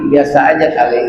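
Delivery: low, offhand shrug of a line; dismissive teen. Retold or imitated. Older child shrugging off his father's praise, low and offhand. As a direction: brush off the praise, unimpressed.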